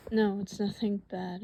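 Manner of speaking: normal talking voice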